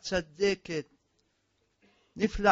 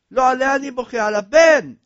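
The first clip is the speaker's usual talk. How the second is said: king's cry; 4 semitones higher, 10 dB louder than usual. Acted. King answers his courtiers.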